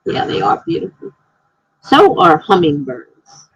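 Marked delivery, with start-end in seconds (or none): 0.1-1.1 breathy high whisper, much softer; hushed awe at butterflies; soft admiring. Real, not acted. Agreeing about butterflies.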